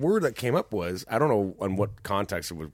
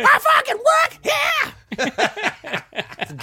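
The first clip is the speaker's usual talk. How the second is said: very high, full-throated mock rock scream; 9 dB louder, much slower than usual. Repeating his terrible attempt for laughs, hammed-up rock screech. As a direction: screech it like a hard-rock frontman. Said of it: "that was an A C D C singer".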